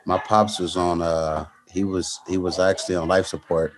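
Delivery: low, quiet, heavy-hearted telling; subdued. Real, not acted. Fighter tells interviewer about his father on life support, subdued. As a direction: keep it soft and low, holding the feeling in.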